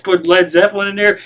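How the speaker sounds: normal talking voice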